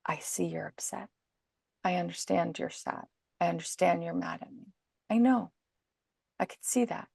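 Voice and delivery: hushed, near-whispered steady reassurance; calm quiet empathy. Shown as a demonstration. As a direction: softly acknowledge the child's feelings without giving in. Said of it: "You're gonna say to them".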